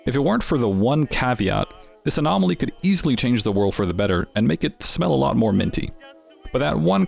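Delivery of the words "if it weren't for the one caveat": ordinary delivery, nothing marked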